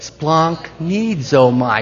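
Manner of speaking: "Splanchnizomai" very slow and evenly drawn out; pronunciation demo. Shown as a demonstration. Preacher leading the congregation to try it, slow demonstration. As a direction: draw out "Splanchnizomai" so others can join in. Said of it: "You wanna say it with me?".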